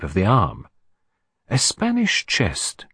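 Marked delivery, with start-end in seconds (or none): 1.5-2.9 slow, higher pondering; musing. Acted. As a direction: turn the phrase over slowly. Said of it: "he mused".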